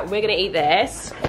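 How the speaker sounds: usual speaking voice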